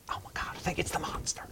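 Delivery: fast, high, terrified whisper; panicked whisper in the dark; frightened whisper. Acted. Hiding villager whispering in fear of the monster. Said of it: "You hear one voice say".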